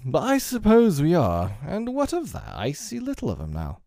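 high, theatrical gentleman's voice with big swoops; jovial and very animated; hearty Doctor Lanyon, chuckling. A narrator's reading. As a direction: play a boisterous, genial old friend.

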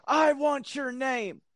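hammed-up demand for a name; a hint of an angry customer; irritated demand. Angry man demanding officer's name.

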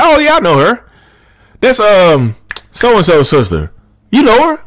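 high-pitched, breezy feigned recognition; put-on nonchalance with high, questioning endings; cool playing along. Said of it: "been cool, calm, and collected and played along".

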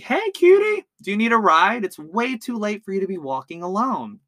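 mock-flirty catcall, high and loud; luring catcall.

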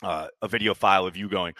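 ordinary talk, nothing marked

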